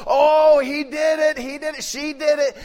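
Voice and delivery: put-on high, loud whiny tattling child; tattling kid. Parent imitating kids tattling on each other, high and whiny. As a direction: tattle like an excited little kid. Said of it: "I'm gonna tattle on it".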